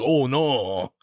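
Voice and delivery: mock French accent on oh no; a light, jokey French accent. Shown as a demonstration. Cohost redoing her reaction to the baby, in mock French accent. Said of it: "in, like, a French accent?".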